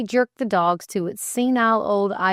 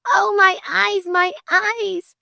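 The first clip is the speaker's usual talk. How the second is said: high, loud mock cry of pain; 12 semitones higher, 6 dB louder, slower than usual. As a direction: feign that the light hurts your eyes. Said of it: "she cried".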